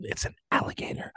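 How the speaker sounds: a hushed, secretive reveal; whisper. Retold or imitated. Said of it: "acted coworker reply".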